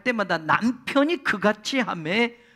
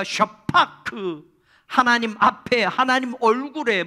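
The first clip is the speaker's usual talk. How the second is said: ordinary talk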